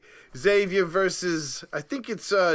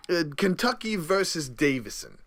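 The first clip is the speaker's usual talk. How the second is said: ordinary delivery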